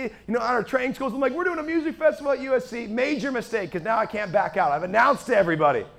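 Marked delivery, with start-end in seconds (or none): 1.3-2.9 high-pitched eager proclamation; excited announcing. Retold or imitated. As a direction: spread the news with high, eager energy. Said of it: "I start announcing it to everyone".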